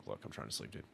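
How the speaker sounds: low sleepy grumble, annoyed; voicing the cat. Owner voicing her sleepy cat, grumpy low mumble. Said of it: "she just gave me a look like".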